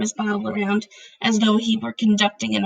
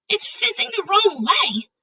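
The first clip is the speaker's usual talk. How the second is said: cried out; 11 semitones higher than usual. A narrator's reading. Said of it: "Sophie cried".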